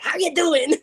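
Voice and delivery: high-pitched comic greeting; funny scare-actor voice. Retold or imitated. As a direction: pop out with a goofy, sing-out hello. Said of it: "trying to be, like, all, like, funny".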